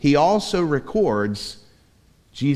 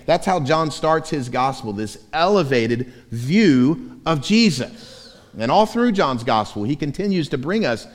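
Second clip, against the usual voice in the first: ordinary talk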